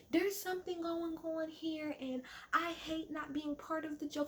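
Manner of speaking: high mock-childish complaint; whiny five-year-old. [name] complaining about being left out of the joke, five-year-old whine. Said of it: "She's literally acting like a five-year-old".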